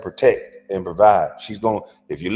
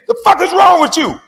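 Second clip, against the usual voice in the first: high, loud angry outburst; angry shout; 6 dB louder than usual. Shown as a demonstration. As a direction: shout it like an angry man confronting someone. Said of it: "that's angry".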